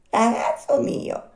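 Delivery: high, very slow, placid snail; unhurried snail. Acted. Snail refusing to hurry for the puppet, slow placid snail voice. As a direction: draw it out with total phlegm. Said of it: "tutta pace e tutta flemma".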